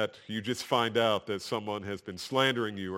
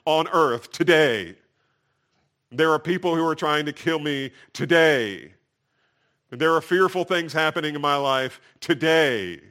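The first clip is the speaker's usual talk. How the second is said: louder, driving sermon delivery; forceful preaching; 4 semitones higher, 8 dB louder than usual. Real, not acted. Preacher stresses David's danger is today, forceful. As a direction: press the urgency on the congregation.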